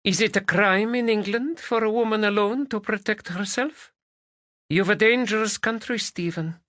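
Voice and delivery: put-on high, accented Spanish woman's voice; Spanish lady. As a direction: voice her as a lilting Spanish lady.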